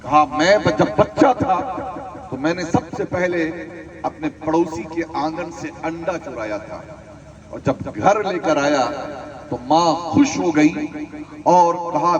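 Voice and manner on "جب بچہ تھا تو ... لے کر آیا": ordinary talk, nothing marked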